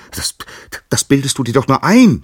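high, loud scream of protest. Acted. Gaia screaming at her husband Uranus, high and loud. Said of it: "schrie sie".

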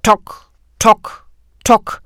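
loud hollow tock tock knocks; knocking sound. A narrator's reading. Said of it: "das seltsame Geräusch erneut".

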